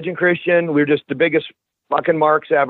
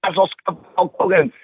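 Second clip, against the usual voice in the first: mock angry friend; 4 semitones higher than usual. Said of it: "He'd be like".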